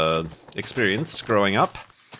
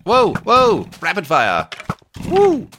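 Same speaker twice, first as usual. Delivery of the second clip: startled shout; 10 semitones higher, 7 dB louder, slower than usual. Real, not acted. Surprised by sudden attack in game.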